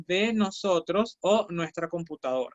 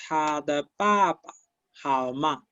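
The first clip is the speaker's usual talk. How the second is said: slow model phrase; 2 semitones lower, slower than usual. Shown as a demonstration. Teacher modeling ta de papa for the class, slowly. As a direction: lay the Chinese out slowly for learners. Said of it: "Es lo que estaba diciendo".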